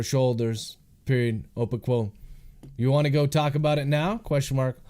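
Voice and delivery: measured dictation, calling out punctuation; dictating. Real, not acted. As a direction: read the draft aloud with spoken punctuation. Author dictating his novel aloud.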